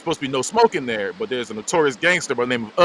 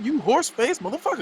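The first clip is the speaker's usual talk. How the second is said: retold going off; 11 semitones higher than usual. Man insulted in an argument, retold going off. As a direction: go off on him in a raised pitch. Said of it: "He started going off".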